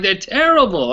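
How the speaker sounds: put-on high-pitched Pittsburgh old-timer accent, disgusted; mock the old fan's Pittsburgh accent, high and scornful; Pittsburgh accent impression. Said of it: "I'll try to do his accent".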